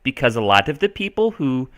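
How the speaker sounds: lilting put-on Minnesotan accent; Minnesota accent. Shown as a demonstration. Teacher carried away explaining settlers, put-on Minnesota accent. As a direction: keep up the Minnesotan imitation, Nordic-tinged. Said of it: "I gotta stop that".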